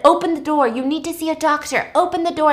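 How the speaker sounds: high, insistent retold order; urgent demand. Virgil demanding injured Claire let him in, urgent.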